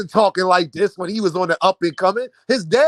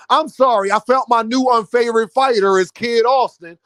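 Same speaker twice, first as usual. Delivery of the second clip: ordinary talk, nothing marked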